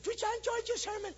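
high, put-on voice of a church lady; mock Effie. Preacher imitating Effie at the door, high and put-on. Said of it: "Here comes old Effie out".

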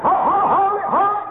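high, loud repeated stop; urgent shout. Retold or imitated. Preacher shouts at his son to stop, high and loud. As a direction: yell hold it in a panic.

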